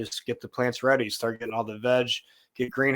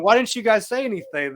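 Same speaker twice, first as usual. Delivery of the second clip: higher-pitched, indignant questioning; retold exasperated protest; 9 semitones higher, 4 dB louder than usual. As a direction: act out demanding an explanation.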